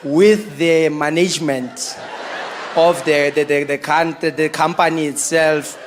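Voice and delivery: put-on slow, low African accent with halting restarts.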